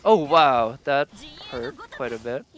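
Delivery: normal talking voice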